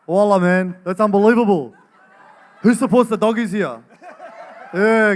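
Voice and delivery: loud, high Punchbowl Lebanese-Australian accent; hammed-up western Sydney Lebanese accent, full volume. Shown as a demonstration. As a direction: put on a big, shouty Punchbowl accent. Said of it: "I can do one more accent".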